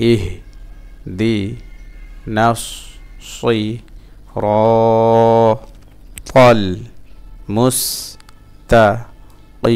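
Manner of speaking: ইহ to ই in separate chanted pieces; broken-up syllables. Shown as a demonstration. Teacher repeating a Fatiha verse for learners, halting chant. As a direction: stretch র and ই, leave gaps between syllables. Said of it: "ভাঙা ভাঙা উচ্চারণ".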